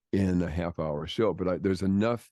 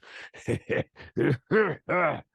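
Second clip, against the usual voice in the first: put-on wheezy old man laugh; 9 semitones higher than usual.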